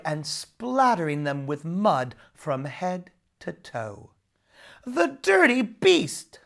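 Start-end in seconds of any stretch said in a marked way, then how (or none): 4.8-6.4 high, loud, slow shriek of disgust; screamed. A narrator's reading. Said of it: "screamed Nora".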